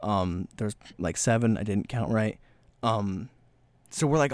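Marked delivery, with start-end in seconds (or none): none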